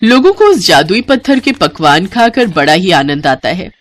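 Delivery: ordinary delivery, nothing marked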